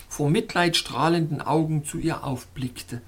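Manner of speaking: plain reading voice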